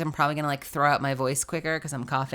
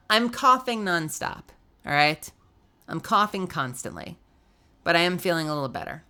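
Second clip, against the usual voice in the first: usual speaking voice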